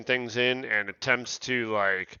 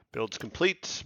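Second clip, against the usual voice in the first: usual speaking voice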